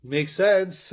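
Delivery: dry sarcastic remark. Acted. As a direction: lay the sarcasm on thick. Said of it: "that was sarcasm. Laying it on pretty thick".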